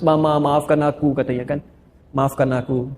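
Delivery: a hint of a sobbing boy asking forgiveness; tearful plea. Son hugging his mother at night, softly tearful. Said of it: "dia menangis".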